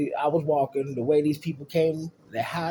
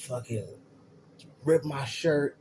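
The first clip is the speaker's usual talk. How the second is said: usual speaking voice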